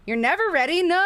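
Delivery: high, loud mock disbelief; mock surprise. Real, not acted. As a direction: act shocked at the chat. Reacting to chat.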